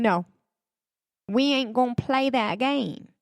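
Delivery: mock British refusal, loosely put on; horrible British accent. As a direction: fake British accent, badly done. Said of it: "I do a horrible British accent".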